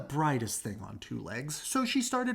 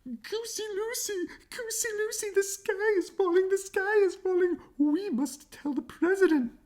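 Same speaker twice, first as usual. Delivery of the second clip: much higher panicked hen cry; clucking hen; 4 dB louder than usual. Acted. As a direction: squawk it out like a frantic chicken. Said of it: "and yelled".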